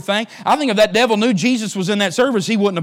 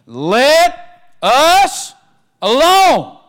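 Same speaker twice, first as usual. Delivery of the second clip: high, loud cry of the demon; 8 semitones higher, 9 dB louder, much slower than usual. Acted. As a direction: shout it out as the demon. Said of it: "he said it with a loud voice".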